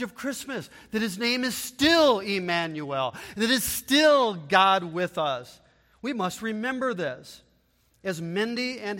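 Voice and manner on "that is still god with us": normal talking voice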